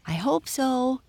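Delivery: high-pitched pony's reply; calling back. A narrator's reading. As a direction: call back hopefully as the pony. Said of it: "called back the pony".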